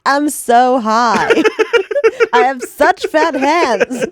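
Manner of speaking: slow giggly mock high person; hammed-up stoned drawl, laughing. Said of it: "People were like".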